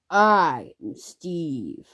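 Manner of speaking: drawn-out movie catchphrase impression. Kid quoting a viral movie clip to viewers, slow catchphrase impression. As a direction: stretch each word out slowly, falling away.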